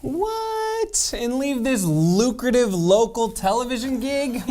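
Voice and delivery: high-pitched playful protest; mock disbelief. Co-anchor reassuring colleague she won't leave, mock disbelief. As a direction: protest it in a high, put-on incredulous voice. Acted protest at being asked about leaving.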